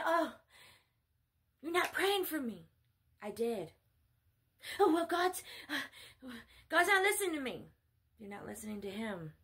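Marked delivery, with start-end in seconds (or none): 0.1-2.7 higher-pitched imitated grievance; mock complaint. Upset follower accuses preacher of not praying, mock complaint. 4.8-7.7 high, louder imitated whine; mock whining complaint. Follower complains that God ignores them, mock whining.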